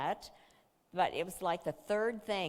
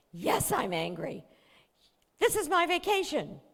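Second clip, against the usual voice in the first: high, loud indignant insistence; loud, high sulky protest; angry teen; 10 semitones higher, 5 dB louder than usual. Retold or imitated. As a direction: dig in, still furious. Daughter insists angrily.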